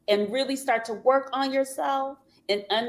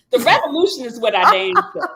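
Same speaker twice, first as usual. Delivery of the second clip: loud, higher, forceful restatement; emphatic insistence; 4 semitones higher, 9 dB louder, faster than usual. Real, not acted. Host restating the revolution to the panel, loudly insistent. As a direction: insist over the crosstalk, punching it out. Insists on own word over interruptions.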